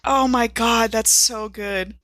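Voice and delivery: ordinary talk, nothing marked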